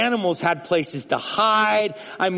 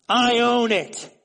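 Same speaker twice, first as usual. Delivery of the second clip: insistent; 7 semitones higher, slower than usual. Shown as a demonstration. Preacher repeating I own it with rising force. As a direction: press the words I own it harder.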